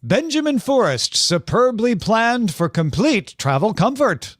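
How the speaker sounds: put-on twenties radio guy with mid-Atlantic accent; vintage mid-Atlantic car ad read. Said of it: "somewhere from Oxford accent to Mid-Atlantic".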